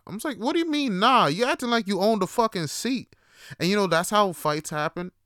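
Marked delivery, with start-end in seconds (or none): 0.4-3.1 high-pitched indignant challenge; incredulous retort. Retold or imitated. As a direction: retell it as an outraged comeback. Retold quarrel over a bus seat.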